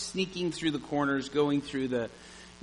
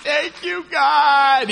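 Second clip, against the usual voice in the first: relieved shout; 13 semitones higher, 13 dB louder, much slower than usual. Retold or imitated. Relief as she runs back.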